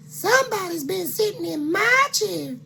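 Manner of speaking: high fluting mama bear. Acted. Mama Bear complaining to her family, high and fluting. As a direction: squeal it up high like a fussy mother. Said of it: "said Mama Bear".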